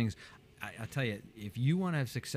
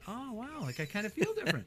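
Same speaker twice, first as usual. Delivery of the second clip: much higher, soft amazed wonder; hushed surprise; 8 semitones higher, 5 dB quieter than usual. Retold or imitated. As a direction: marvel softly at the change. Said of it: "you're like".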